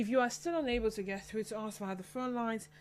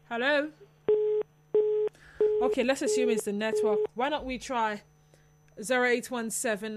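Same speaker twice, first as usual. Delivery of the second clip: louder, rising hello; to someone hard of hearing; 3 semitones higher, 7 dB louder than usual. Real, not acted. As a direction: call out to a faint line. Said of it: "Your voice is very faint".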